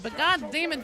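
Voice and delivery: ordinary talk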